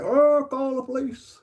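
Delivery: high shrill mock cry for the police; mock girlfriend. Mocking girlfriend threatening to call police, high mock squeal. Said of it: "she's like".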